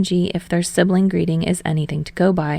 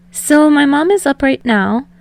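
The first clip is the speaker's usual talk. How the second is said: put-on higher, brighter-pitched boy's voice, a little louder; 7 semitones higher, 5 dB louder than usual. Hinata telling Tsukishima about his mom, eager higher voice. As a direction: lift into an eager young voice.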